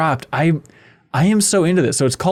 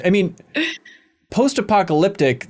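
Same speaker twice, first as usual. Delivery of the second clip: ordinary talk, nothing marked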